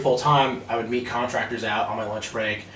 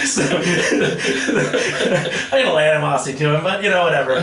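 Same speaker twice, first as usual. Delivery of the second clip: talking through real laughter, loud and higher; laughing; 6 semitones higher, 6 dB louder than usual. Real, not acted. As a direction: get the words out while cracking up. Still laughing after punchline.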